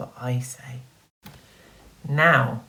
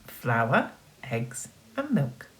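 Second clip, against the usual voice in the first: plain reading voice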